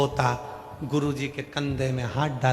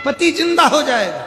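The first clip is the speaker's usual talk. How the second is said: much higher and louder, insisting; loud repeat; 12 semitones higher, 9 dB louder than usual. Acted. Guru insists to the wife, shouting the promise. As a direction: call it out for everyone to hear.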